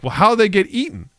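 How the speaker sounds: high, puzzled asking; mock puzzled question. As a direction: raise it like a baffled viewer. Skeptical viewer questioning the plot.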